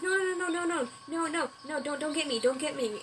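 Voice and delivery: acted high-pitched begging, mildly panicked; panicked pleading. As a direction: plead like prey about to be caught. Story character begs not to be eaten.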